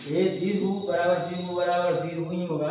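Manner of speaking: ordinary talk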